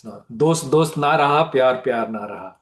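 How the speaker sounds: usual speaking voice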